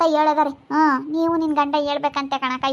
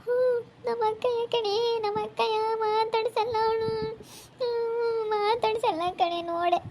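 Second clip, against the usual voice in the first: high, softer, lilting lament; tearful sing-song complaint; 6 semitones higher, 5 dB quieter than usual. Acted. Wife complaining to her friend about her sister-in-law, sing-song lament.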